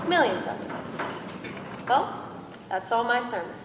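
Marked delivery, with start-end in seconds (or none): 0.1-1.9 slow, breathy whisper of the same words; a slow, hushed echo. Acted. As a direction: let it fall to a slow hush. Repeated line, second whispered.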